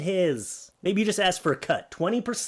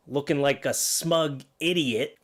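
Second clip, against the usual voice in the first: ordinary delivery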